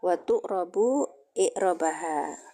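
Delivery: ordinary talk, nothing marked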